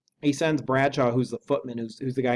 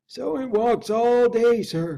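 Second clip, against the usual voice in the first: high, slow hushed servant voice, not truly whispered; hushed butler listening at the door; whispered by Poole; 11 semitones higher, 5 dB louder, slower than usual. Acted. Butler hushes to the lawyer about the footsteps, high and hushed. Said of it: "whispered Poole".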